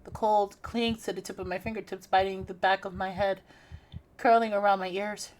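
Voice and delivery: soft, hushed reading of a creepy scene; horror story narration. Acted. Reads horror game narration.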